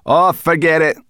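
loud, irritated dismissal; growled. A narrator's reading. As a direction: wave it off grumpily. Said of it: "growled Sorrel".

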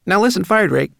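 high, loud, worried rat; fretful little rat demanding attention; anxious. A narrator's reading. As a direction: pitch it up with urgent concern. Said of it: "said the rat anxiously".

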